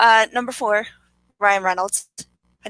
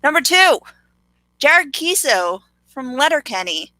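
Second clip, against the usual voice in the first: higher, louder list announcement; countdown announcement; 6 semitones higher, 3 dB louder than usual. Real, not acted. Co-host reveals a countdown pick, excited announcement. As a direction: call out the next big pick.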